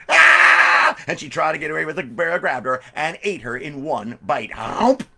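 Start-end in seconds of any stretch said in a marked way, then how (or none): 0.1-0.9 high, loud shriek; piercing storybook scream of fright; girl's scream. Acted. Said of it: "the girl screamed". 4.5-5.0 loud, high gulping chomp; cartoon bear chomp. Bear swallowing the girl.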